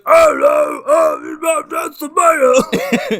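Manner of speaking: loud, high, garbled oafish yell; gigantic kid's voice. Retold or imitated. Mocking the mayor's huge son, loud garbled oaf voice. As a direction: blurt it slowly, loud and mush-mouthed. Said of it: "the whole time he's speaking, he's like".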